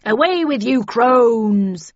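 loud, gruff captain's cry; old sea captain. A narrator's reading. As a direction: drive off the witches like an old captain. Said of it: "he cried".

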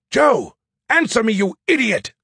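loud, high booming shout. A narrator's reading. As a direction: yell it down the phone. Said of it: "Skippy boomed at me".

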